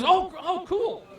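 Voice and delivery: high-pitched impressed reaction; delighted client. Retold or imitated. Client told the fish is a permit, high delighted surprise. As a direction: imitate a thrilled angler, voice way up.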